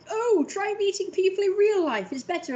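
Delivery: high mocking voice; mocking people. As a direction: parrot the advice. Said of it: "people are like".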